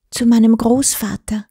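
usual speaking voice

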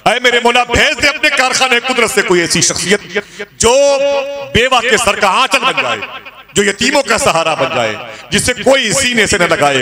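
high, loud climactic plea; fervent sermon climax; impassioned preaching. Acted. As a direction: cry out the prayer with full force. Preaching the girl's prayer.

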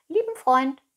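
high, loud cat echo; murmuring cat. A narrator's reading. Cat echoes the fox's words about Pinocchio, high-pitched. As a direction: parrot the fox in a high cat voice. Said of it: "murmelte die Katze".

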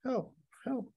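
very high, small cry for help; mild mock scream. A narrator's reading. Woman calls out on an empty street, mock screaming. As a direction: squeak out a half-hearted scream, softly. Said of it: "she screamed".

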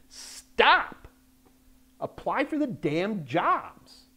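high, loud "stop", then drawn-out insistence; frustrated outburst. Real, not acted. Career adviser urging self-doubting job seekers to apply, frustrated outburst. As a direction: burst out in real exasperation, stretching the words. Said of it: "sorry if I get, uh, loud there. I get very frustrated".

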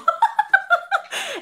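shrieking laugh; high laugh. Real, not acted. Reader laughing at the angel joke, high shriek of laughter. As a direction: burst out high.

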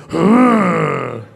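long rumbling "hurrr"; answering growl. Acted. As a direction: growl back at a rival, drawn out. Answers the dirty look.

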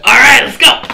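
high, loud rallying call; rally the group, up and loud; Fluffy calling out. Acted. Fluffy rallying the party to go in, loud. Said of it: "Fluffy comes back and goes".

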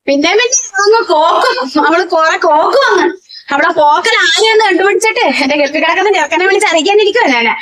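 high, fast, sneering grumble; mocking complaint. Mother-in-law sneers about her son's wife, fast and high.

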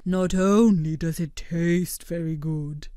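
slow, even creature voice; a calm, polite blob explaining; Blobbert's voice. A narrator's reading. Blobbert praising his pudding to friends, slow and measured.

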